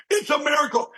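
high, joyful exclamation; elated. Retold or imitated. Telling the puzzled seatmate it's a miracle, high and joyful. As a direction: still bursting with joy.

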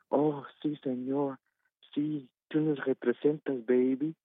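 a mild mock of a gushing mother-in-law; mocking mother-in-law in Spanish. Caller mimics her mother-in-law cheering in Spanish, lightly voiced. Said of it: "I'll say it in Spanish now".

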